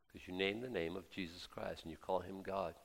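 usual speaking voice

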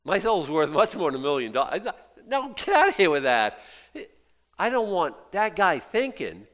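loud, high, fired-up preaching; fiery preaching. Acted. Pastor refusing a million-dollar check, fiery preaching. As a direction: refuse the money with full fire.

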